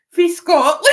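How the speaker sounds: loud, high, laughing through the words; breaking into laughter. Retold or imitated. Retelling her silly answer to the author, cracking up laughing. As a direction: crack up loudly mid-quote.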